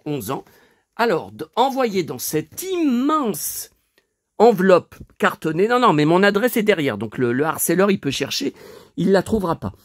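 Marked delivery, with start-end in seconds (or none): none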